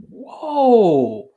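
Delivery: drawn-out amazed whoa; amazed at the Quran's words, drawn-out whoa; awed whoa. Retold or imitated. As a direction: react as if stunned by a revelation. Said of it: "I'd be like".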